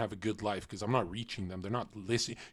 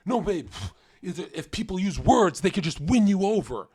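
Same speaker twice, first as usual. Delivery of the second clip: higher, louder mock-earnest boyfriend voice; mocking the clueless boyfriend; 7 semitones higher, 5 dB louder than usual. Streamer mocks a boyfriend talking to his babe, in a higher mock-earnest voice. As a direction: parody a guy reassuring his girl. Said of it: "They go like".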